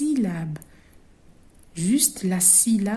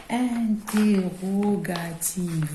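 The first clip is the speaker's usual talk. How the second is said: drawn-out word; much slower than usual. Shown as a demonstration. Teacher stretches new term.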